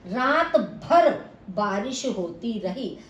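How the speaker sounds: slow, रात भर stressed. Shown as a demonstration. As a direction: lean on रात भर. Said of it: "रात शब्द पर विशेष बल". (रात पर ज़ोर देकर धीरे)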